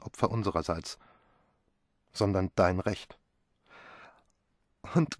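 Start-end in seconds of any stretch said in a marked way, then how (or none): 4.9-5.2 high, loud despairing cry; desperate cry. Acted. Pilar refusing the inheritance in a high desperate cry. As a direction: cry out that she cannot take it. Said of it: "schrie Pilar verzweifelt auf".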